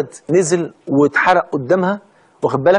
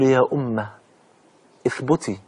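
very soft and low, almost a breath; hushed sacred voice; 6 semitones lower, 6 dB quieter than usual. Acted. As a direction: speak it as a gentle miracle. Infant speaks, hushed. (بصوت خافت جدا)

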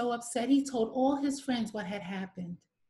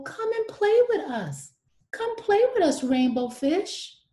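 high, bright playful fish voice, calling out; 13 semitones higher, 7 dB louder than usual. Acted. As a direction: call him over to play, loud and high. Fish inviting him to play.

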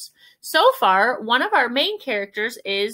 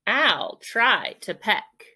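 usual speaking voice

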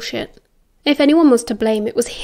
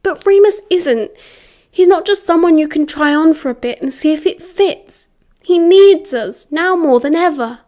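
raised, forceful appeal; urgent plea; 5 semitones higher, 4 dB louder than usual. A narrator's reading. James defending vulnerable Remus to Sirius, urgent and emphatic. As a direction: plead his case, higher and insistent.

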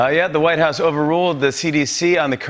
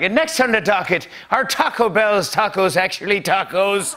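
high, put-on Irish accent for a judge; mock Irish accent; 6 semitones higher than usual. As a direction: play a justice in a silly Irish accent. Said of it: "That's one of my Irish accents".

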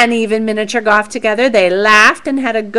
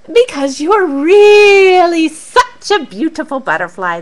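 mice flattering; 5 semitones higher, 6 dB louder than usual. Acted.

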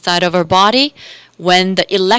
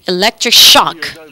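ordinary talk, nothing marked

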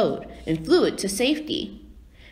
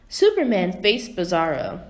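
plain reading voice

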